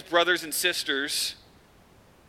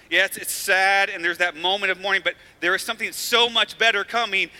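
louder, higher, building preaching; preaching at full force; 5 semitones higher, 6 dB louder than usual. Real, not acted. Pastor preaching to the church about hope beyond death, rising. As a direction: drive home the hope like a pastor.

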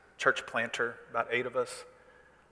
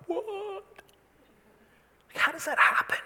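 amazed disbelief. Acted. Parent hearing a baby's first word, high astonished disbelief. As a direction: squeak it out in stunned wonder.

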